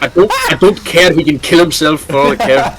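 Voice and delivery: mock Scottish accent. Retelling a friend's outburst to the group, laughing mock Scottish. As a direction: imitate a Scot, laughing as you go. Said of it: "That's Scottish".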